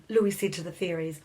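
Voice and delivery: usual speaking voice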